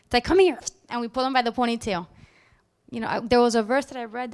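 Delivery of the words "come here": put-on sharp, loud call to a child, pitched up; mock snap at the child to come; angry yell at kid. Mother calling her child over with an angry yell.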